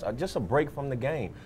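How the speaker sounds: ordinary delivery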